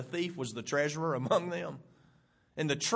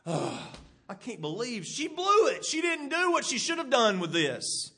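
disgusted snort; 6 semitones higher than usual. Pretend. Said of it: "snort with anger or to sigh".

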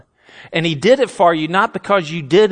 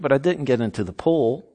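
ordinary talk, nothing marked

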